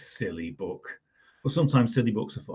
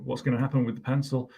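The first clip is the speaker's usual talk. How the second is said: ordinary delivery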